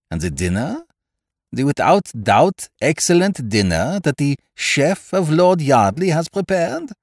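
put-on French-accented gourmet, indignant and emphatic; Poirot. A narrator's reading. Detective objects to missing the excellent dinner, accented indignation.

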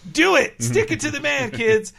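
high, loud cartoon egging-on; Duckman. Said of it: "Duckman steals a soda successfully".

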